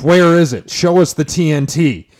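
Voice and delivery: loud, high demand; demanding shout. Pretend. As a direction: insist on seeing the proof. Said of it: "It's like".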